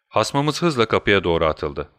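ordinary delivery

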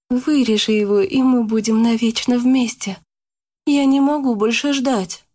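Yana's urging. A narrator's reading. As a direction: beg him impatiently. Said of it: "настаивала Яна".

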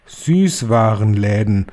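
slow, drawn-out reveal of Süßwarenläden; slow proclamation of Süßwarenläden; announcing. Acted. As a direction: stretch out Süßwarenläden like a grand reveal. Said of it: "rief die Hoch- und Großmeisterhexe".

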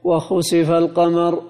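u after خ and i after س in «وخسف»; damma then kasra. Shown as a demonstration. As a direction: say «وخسف» as wa-khusifa. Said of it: "بضم الخاء وكسر السين". («وخسف» بضم الخاء وكسر السين: وخُسِفَ)

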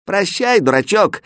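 high, mocking farewell call. A narrator's reading. Boys running away from Aladdin, calling out. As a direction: call it out lightly while running off. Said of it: "крикнули, убегая, мальчишки".

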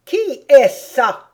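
loud, slow and high demand; ungracious queen. A narrator's reading. Queen asks who the girl is, ungraciously. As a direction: demand it imperiously, drawing out each word. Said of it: "demanda la reine avec fort peu de gracieuseté".

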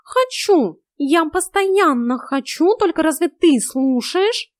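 high, loud, slow complaint; drawn-out high-pitched reproach; exasperated cat. A narrator's reading. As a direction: complain loudly as an unheeded cat.

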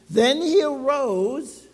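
ordinary talk, nothing marked

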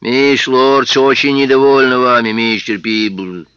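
loud, slow, menacing; menacing policeman. Acted. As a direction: scold him, drawing out the name. Library policeman voice.

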